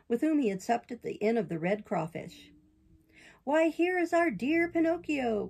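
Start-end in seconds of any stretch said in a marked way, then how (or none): 3.4-5.5 higher, slow, hammed-up greeting; a sly fox gushing over an old friend; mock delighted cry. The fox greets Pinocchio with hammed-up delight. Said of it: "cried the fox".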